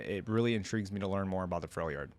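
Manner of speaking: ordinary talk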